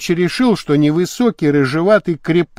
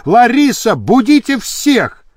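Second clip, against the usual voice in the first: higher, urgent order; calling out; 7 semitones higher than usual. Acted. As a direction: call to a helper to rouse everyone. Зовёт помощницу разбудить всех. (громко зовёт, приказывая)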